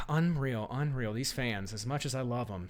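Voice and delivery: normal talking voice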